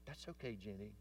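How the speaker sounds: put-on soft and patient with his little girl; gentle father. Father answers daughter gently.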